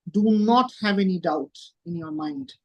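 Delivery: ordinary delivery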